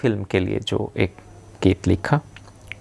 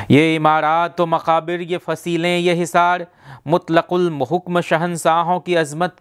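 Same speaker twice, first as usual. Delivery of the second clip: higher-pitched, measured Urdu verse declamation; poetry recitation; 6 semitones higher than usual. Acted. Reciting Sahir's Taj Mahal poem to a beloved, measured poetic declamation. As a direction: open each stanza high and descend line by line.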